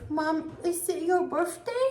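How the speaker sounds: put-on a small child asking wide-eyed; curious little girl. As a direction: daughter asking mom about her birthday, high and slow. Mother voices daughter on birthday.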